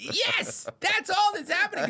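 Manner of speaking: very high, gleeful exclamation; real high-pitched delight; excited agreement. Real, not acted. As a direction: agree in a thrilled squeal. Excited agreement while laughing.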